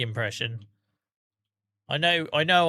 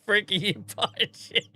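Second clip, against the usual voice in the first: high mock outburst breaking into laughter; retold shock; 6 semitones higher, 4 dB louder than usual. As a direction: mimic your own shocked blurt, laughing. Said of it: "I was like".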